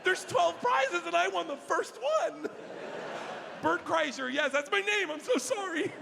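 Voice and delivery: high, laughing, breathless-with-joy babble; hammed-up thrilled winner; giddy excitement. Giddy winner at prize call.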